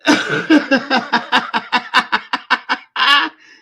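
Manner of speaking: long, loud, high cackling laugh; uncontrollable laughter. Real, not acted. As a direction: laugh helplessly at full volume. Laughing fit breaks out afresh.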